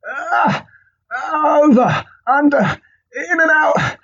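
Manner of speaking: high strained grunts while tying shoes; meathead grunting. Retold or imitated. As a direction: grunt each step of the shoelace like a heavy lift. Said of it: "doing their shoelace up like".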